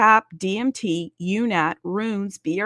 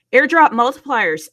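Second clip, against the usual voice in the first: announcing; 4 semitones higher, 9 dB louder than usual. Real, not acted. Announcing the next topic.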